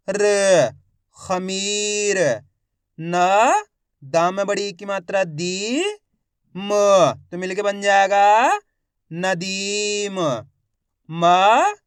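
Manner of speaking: each letter held long with pauses, then the word stretched; slow sounding out. Shown as a demonstration. Teacher spelling out words for learners, slow and drawn out. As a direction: draw out every syllable for learners to repeat. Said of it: "एक ही अक्षर का उच्चारण कर रहा हूँ".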